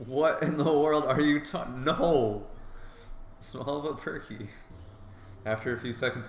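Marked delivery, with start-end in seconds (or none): 0.1-2.5 louder, higher, slowed shocked cry; a flustered yelp of protest; startled protest. Acted. Carl grabs at him. 3.4-4.5 teasing verdict. Acted. Teasing chest comparison.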